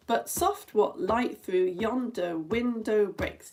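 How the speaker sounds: measured scansion of "yonder window"; iambic pentameter. Shown as a demonstration. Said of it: "that is iambic pentameter".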